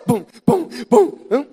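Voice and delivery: high mock drum thumps; imitated drum beats. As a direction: beat out the drum with your voice. Imitating drums in worship.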